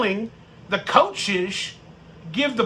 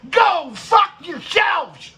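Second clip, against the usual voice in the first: slow, higher, loud angry shout; salty outburst; 6 semitones higher, 3 dB louder, slower than usual. Real, not acted. Fan yelling at critics, slow furious shout. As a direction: spit it out at the doubters. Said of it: "That's my salty".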